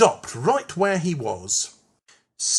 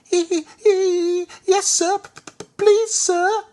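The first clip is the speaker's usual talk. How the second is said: very high, louder frightened stutter; nervous stammer; 5 dB louder than usual. A narrator's reading. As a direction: stutter a timid plea. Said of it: "Percy was nervous".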